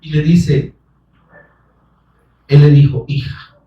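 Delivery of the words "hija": whispered, tender answer; Jesus whispering kindly to the healed woman; soft whisper. Acted. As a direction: breathe it to her gently.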